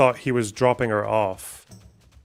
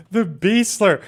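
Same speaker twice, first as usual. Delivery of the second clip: mocking; 10 semitones higher, 5 dB louder, slower than usual. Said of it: "you can say he calls women bitches".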